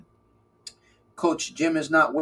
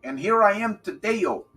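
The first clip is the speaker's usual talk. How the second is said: playful rhyme; 5 semitones higher than usual. Acted. Playful rhyming bit.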